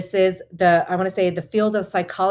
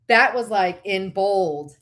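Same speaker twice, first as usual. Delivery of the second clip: ordinary talk, nothing marked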